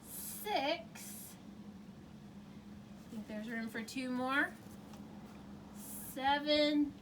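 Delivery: put-on cheerful counting for children; kids show host.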